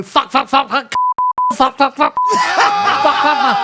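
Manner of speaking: a caller shouting swears on air, drawn out; radio caller yelling swears live on air; shouted swearing. Retold or imitated. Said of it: "I'd just like to go".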